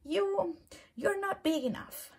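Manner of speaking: high, loud, cheeky challenge; grouchy ladybug voice. Acted. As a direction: pick a fight in a squeaky bug voice. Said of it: "said the grouchy ladybug".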